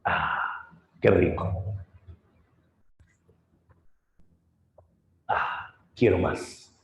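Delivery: low, slow, half-whispered sigh of pleasure; drunk cyclops savoring. Acted. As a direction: savor the wine like a greedy giant. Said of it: "Polifemo comenzó a probar". (cíclope borracho saboreando el vino en voz baja)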